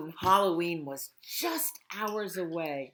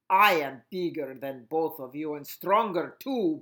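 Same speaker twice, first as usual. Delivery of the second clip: a hint of a boastful vampire, a touch louder; boasting vampire; 7 dB louder than usual. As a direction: brag like a smug vampire. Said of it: "boasted the vampire".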